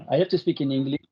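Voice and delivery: English with a strong French accent, as a short test line; a French presenter testing the call system in French-accented English. Real, not acted. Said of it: "désolé pour mon accent, euh, anglais qui est pas extra".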